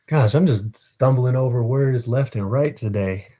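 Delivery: ordinary delivery, nothing marked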